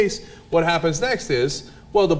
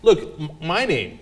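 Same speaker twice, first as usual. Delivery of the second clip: ordinary talk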